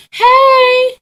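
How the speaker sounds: high, loud happy hey; excited greeting. Real, not acted. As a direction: call out hey with delight.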